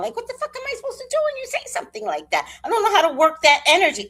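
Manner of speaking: fast and high rant; exasperated rush. Real, not acted. Venting about mom's comment, fast and high. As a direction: rattle it off in disbelief.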